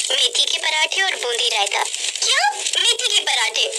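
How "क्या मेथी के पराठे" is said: incredulous echoed question; displeased disbelief. Acted. Sister-in-law rejecting the offered breakfast, in disbelief. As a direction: repeat it as a put-off question. Kavya scorns the suggested breakfast.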